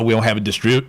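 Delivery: normal talking voice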